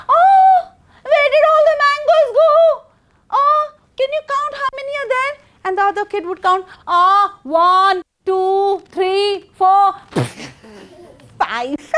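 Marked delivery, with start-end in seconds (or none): 0.1-5.3 high, loud, mocking kids; taunting children. Children taunting the old woman. 6.9-11.9 high, giggling count to five; mocking child counting.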